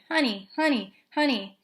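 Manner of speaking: repeated bird-like "Honey"; a mynah bird mimicking; parrot squawk. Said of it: "Uncle Feather started".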